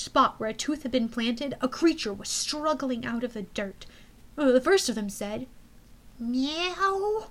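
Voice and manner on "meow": kitten meow. Acted. Kitten speaks.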